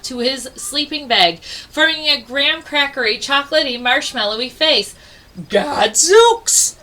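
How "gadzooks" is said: high, louder startled gasp. Acted. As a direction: gasp it out in shock. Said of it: "gasped the bear".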